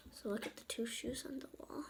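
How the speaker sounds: soft unvoiced whisper to the viewers. Real, not acted. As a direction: whisper it like a secret. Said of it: "whispers to viewers".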